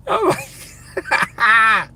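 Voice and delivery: high squealing laughter, barely speaking; breaking up with laughter; laughing hard. Real, not acted. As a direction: lose it laughing mid-phrase. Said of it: "laughing hard".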